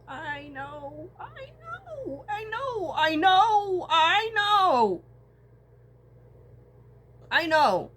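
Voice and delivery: crying out through the tears; real crying. Real, not acted. Vlogger breaking down to viewers, sobbing. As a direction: voice breaking high into sobs.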